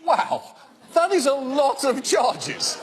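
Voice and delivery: high-pitched, drawn-out comic awe; slow, exaggerated wonder; mock amazement. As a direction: react as if hugely impressed, stretching it slowly. Mock amazement after news clip.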